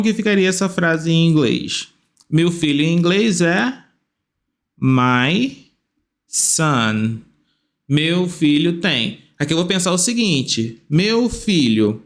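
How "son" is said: ordinary talk, nothing marked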